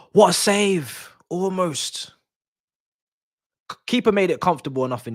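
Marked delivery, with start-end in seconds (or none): 0.0-2.1 sharp gasp, then slow amazement. Real, not acted. Watching a near goal, sharp gasp and awe. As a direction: gasp high then marvel at the save.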